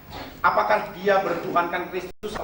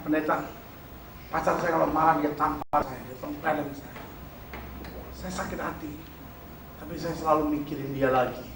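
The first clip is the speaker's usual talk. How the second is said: mild sad hush; 3 semitones lower, 7 dB quieter than usual. Retold or imitated. Voicing hurt girlfriend's confession.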